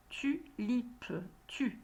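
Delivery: "Tulipe" broken after "Tu"; split into syllables. Shown as a demonstration. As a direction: say "Tulipe" in two separate syllables, Tu, lipe. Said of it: "j'entends la syllabe tu". («Tulipe» découpé en syllabes)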